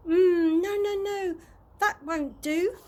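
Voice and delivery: high, loud fussy producer voice. A narrator's reading. TV producer rejecting Mister Stink's look, high and fussy. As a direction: dismiss him with a fussy flourish. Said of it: "said the T V producer".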